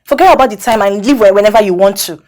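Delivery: loud, high, bitter outburst; angry shout. Acted. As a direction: yell it in sarcastic fury. Mistress angrily confronting lover.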